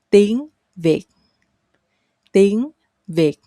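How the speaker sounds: "Việt" with v, after a rising "Tiếng"; standard V sound. Shown as a demonstration. Teacher recommends this for learners, "Việt" with a v. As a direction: lift "Tiếng", begin "Việt" with an English v. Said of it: "start with V sound".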